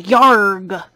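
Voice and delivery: gruff pirate growl; a loud pirate yarg. Pretend. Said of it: "I'll work on my pirate voice".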